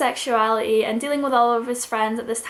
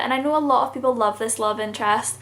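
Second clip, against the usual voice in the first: usual speaking voice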